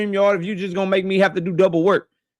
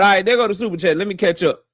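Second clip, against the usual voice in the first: normal talking voice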